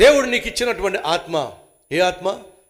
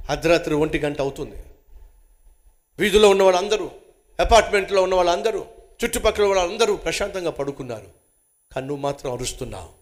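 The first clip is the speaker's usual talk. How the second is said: ordinary delivery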